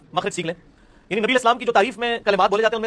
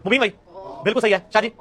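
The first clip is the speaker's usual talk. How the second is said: usual speaking voice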